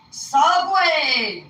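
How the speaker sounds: high, drawn-out "Subway"; imitated mishearing. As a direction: mimic someone insisting on "Subway". Imitating people who misheard her.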